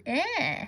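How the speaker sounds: "eh" as an isolated short E; short E sound. Shown as a demonstration. Teacher showing what A and I make, short E "eh". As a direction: hold "eh" as a pure short vowel. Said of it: "that short E sound".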